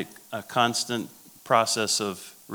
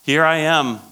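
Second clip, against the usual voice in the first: raised, loud protest as Moses; incredulous Moses; 5 semitones higher, 6 dB louder than usual. Acted. Moses protesting to God in a Bible verse, incredulous. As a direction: exclaim it like a disbelieving Moses. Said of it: "Moses is laughing at God".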